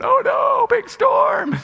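very high, loud hammed-up cry; squealed fake alarm; mock stage panic. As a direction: overact terror like a high school play. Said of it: "Everyone going".

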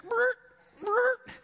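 high short tire-chirp noises; imitated car lurches. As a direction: mimic a car jerking forward with your voice. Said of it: "they're kinda like".

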